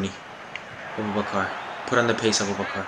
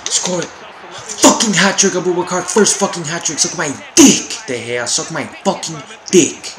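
loud, high gloating roar; real jubilant yell; triumphant shouting; 7 semitones higher, 5 dB louder than usual. Real, not acted. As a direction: yell the hat trick in the keeper's face. Celebrating a hat trick.

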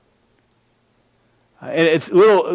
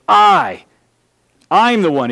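"I" punched louder and higher, then a beat before "I'm the one"; emphatic I; 3 semitones higher, 4 dB louder than usual. Shown as a demonstration. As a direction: hit "I" hard and let it stand alone. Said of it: "very emphatic".

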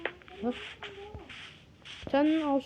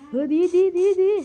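loud, higher, eager cheer; excited approval; 4 semitones higher, 5 dB louder than usual. Acted. Friend hears the bank robbery plan and cheers loudly. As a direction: cheer the bank-robbing idea.

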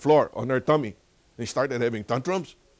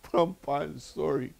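put-on higher little-girl apology; apologetic granddaughter; 5 semitones higher, slower than usual. Granddaughter turns round to grandpa after spankings, apologetic. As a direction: lift the pitch for a small child's sorry. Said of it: "turn around and tell me".

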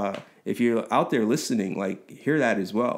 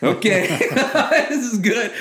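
laughing relief; 11 semitones higher, 6 dB louder than usual. Retold or imitated. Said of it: "I was like".

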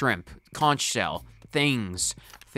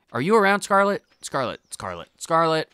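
calling out; 4 semitones higher, 4 dB louder than usual. Real, not acted. Searching, calling Scarlet's name.

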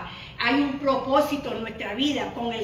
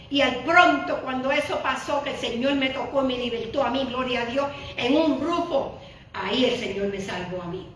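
ordinary talk, nothing marked

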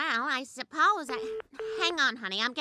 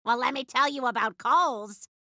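ordinary talk

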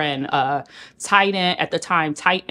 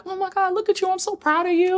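proud gushing Serena. Retold or imitated. Voicing Serena's praise.

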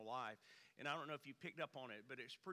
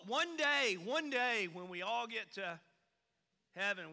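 high, loud mock rejoicing; mock hymn; 6 semitones higher, 9 dB louder than usual. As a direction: parody the churchy promise. Mocking religious cliche, sung-style.

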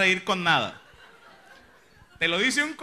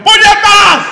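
a sudden furious yell, much higher and louder; furious shout; 13 semitones higher, 14 dB louder, much slower than usual. Retold or imitated. Cursing at the car that cut in, a furious shout. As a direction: bellow the curse at the car.

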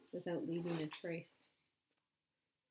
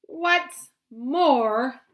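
high, loud snap; snapping Lorax; 6 semitones higher, 18 dB louder than usual. Acted. Lorax snaps at the Once-ler, high and loud. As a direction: bite it off as the cross Lorax. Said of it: "snapped the Lorax".

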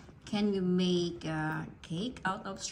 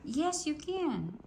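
higher, slow playful insist; 8 semitones higher, slower than usual. Real, not acted. Child says no, parent insists.

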